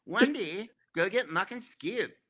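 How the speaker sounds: high, cheery cartoon builder catchphrase; sing-song it like the kids' show hero; Bob the Builder. Shown as a demonstration. Still doing Bob for the host, high and bright. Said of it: "And that's Bob the Builder".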